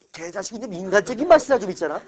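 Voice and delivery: excited. Real, not acted. Teacher jokes about a curse word.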